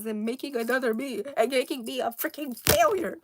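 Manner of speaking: fast rant building to a loud yell; hammed-up furious tirade, fast, ending in a yell. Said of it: "this man is furious".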